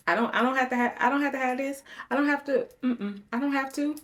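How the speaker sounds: high, loud, worked-up refusal; real distressed indignation; upset protest. Real, not acted. Upset, protesting living with snakes.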